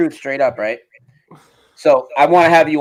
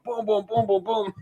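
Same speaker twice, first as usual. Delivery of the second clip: high, soft rapid-fire booms; light, high-pitched "boom boom" sound effect; imitated booming; 8 semitones higher, 8 dB quieter than usual. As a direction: mimic booms in a small high voice. Mimics someone going off loudly.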